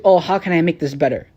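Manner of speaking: usual speaking voice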